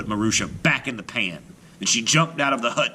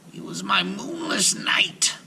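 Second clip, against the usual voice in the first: witch whisper; 11 semitones higher than usual. A narrator's reading.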